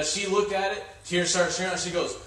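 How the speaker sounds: normal talking voice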